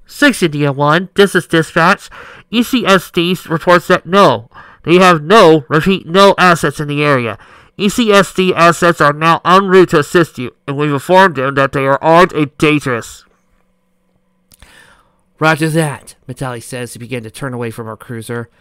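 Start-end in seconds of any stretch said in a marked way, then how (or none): 0.1-13.2 loud dispatcher over radio; crackly radio dispatch call. Acted. 15.4-16.1 loud. Acted. Officer answers radio.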